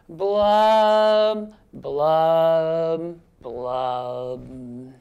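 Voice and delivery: long blubs stepping down in pitch; gloomy fish blubs. Acted. Teacher leads children in the blub part, descending blubs.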